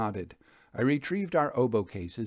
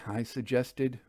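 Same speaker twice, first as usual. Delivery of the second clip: plain reading voice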